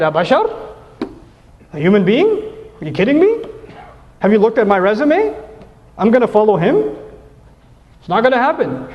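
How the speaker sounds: incredulous, prideful mock questions rising in pitch; imitating haughty disbelief; Iblis. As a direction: act offended pride, with pauses between each scoff. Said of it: "That is the same exact pride".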